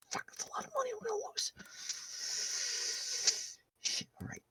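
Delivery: urgent whispered curse under the breath; panicked whisper over a losing bet. Acted. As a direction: hiss it so no one hears. GM voices Vinny muttering anxiously.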